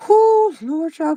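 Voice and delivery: high exasperated whoop to the Lord; a high overwhelmed cry; overwhelmed whoo. Real, not acted. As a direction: let out a high, frazzled whoo. Overwhelmed by play rehearsals.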